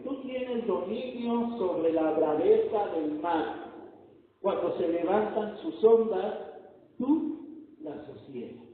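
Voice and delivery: loud, ringing preacher's proclamation; full-force preaching. Acted. Preacher booming the sermon point to the congregation. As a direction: proclaim it to the whole church.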